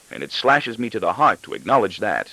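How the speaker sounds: usual speaking voice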